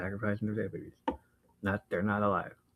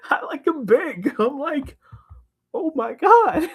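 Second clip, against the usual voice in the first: high, loud, breaking into laughter; laughing glee; 7 dB louder than usual. Real, not acted. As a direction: squeal it through the giggles. Cracking up over flirty scene.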